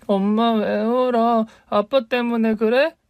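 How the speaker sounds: put-on a small girl asking her crying mom; little girl. Child asks crying mother. (우는 엄마에게 묻는 어린 딸의 높고 느린 목소리)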